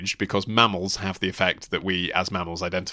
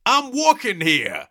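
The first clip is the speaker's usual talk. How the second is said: high, drawn-out mock New York yell; hammed-up New York accent, slow and indignant; aggrieved New York bellow; 12 semitones higher, 4 dB louder, slower than usual. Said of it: "bellowing the phrase ... in an aggrieved tone".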